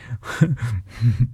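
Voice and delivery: short acted chuckle; low chuckle. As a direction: chuckle under the breath in amusement. Taurus amused, laughs before replying.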